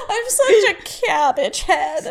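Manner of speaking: slow, giggling self-mockery; laughing. Real, not acted. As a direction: laugh it out at yourself. Laughing at herself.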